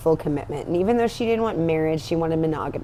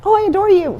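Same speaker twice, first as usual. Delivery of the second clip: high, loud, overdone adoration; mock gushing lover; 12 semitones higher, 7 dB louder than usual. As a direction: gush it like a lovesick fool. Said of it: "female Orlando who is like".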